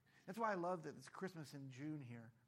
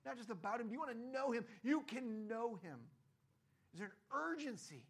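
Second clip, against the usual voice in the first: rising, louder urgent preaching; preaching at full force; 6 semitones higher, 6 dB louder than usual. Acted. Pastor urging the church, loud urgent appeal. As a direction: push them toward urgency, louder.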